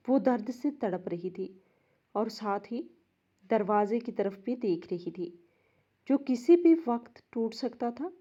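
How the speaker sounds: plain reading voice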